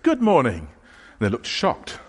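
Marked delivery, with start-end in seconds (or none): 0.0-0.6 a high, loud, cheery good morning; beaming hello at the front door; bright greeting. Retold or imitated. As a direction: greet them with over-the-top friendliness.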